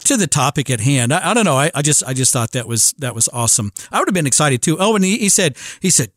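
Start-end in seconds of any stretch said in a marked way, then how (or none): none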